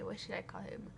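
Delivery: ordinary talk, nothing marked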